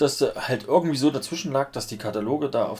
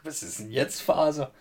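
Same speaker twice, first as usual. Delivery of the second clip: normal talking voice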